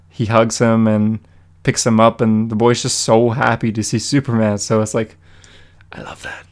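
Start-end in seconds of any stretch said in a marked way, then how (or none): 5.9-6.4 barely voiced, whispered aside; hushed fondness. Real, not acted. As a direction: whisper it, very soft. Aside on a touching moment.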